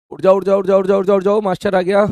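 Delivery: teacher calling out to wake the class; mock wake-up call. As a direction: rouse the sleepy class loudly, half joking. Teacher jokingly waking up students.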